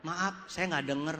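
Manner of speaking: lightly voiced hard-of-hearing guest, low and slow; apologetic guest. Hard-of-hearing guest answers the speaker's question, lightly apologetic.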